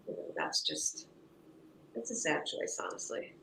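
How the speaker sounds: hushed, sad whisper. Real, not acted. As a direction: drop to a near whisper. Sad remark, trails off.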